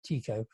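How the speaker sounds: Tycho said as TEE-ko; Danish pronunciation. Shown as a demonstration. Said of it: "as the Danes say".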